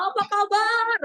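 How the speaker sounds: high loud MC greeting; stage greeting. Shown as a demonstration. MC standing still on stage greeting the audience loudly. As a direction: call out to the crowd from centre stage. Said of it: "kita cuman diri di tengah".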